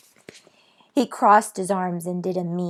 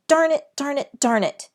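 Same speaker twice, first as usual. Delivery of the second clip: frustrated outburst; 7 semitones higher, 4 dB louder than usual. A narrator's reading.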